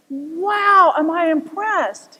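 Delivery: mildly amazed exclamation; impressed pilot. Retold or imitated. Joke teller voicing the pilot, lightly amazed.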